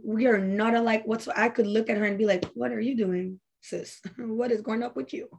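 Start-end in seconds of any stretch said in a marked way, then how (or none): 2.5-5.3 soft, teasing chide with a laugh; gently roast your old self, laughing; teasing soft scold. Retold or imitated. Looking back at her past self, she teases her softly with a laugh.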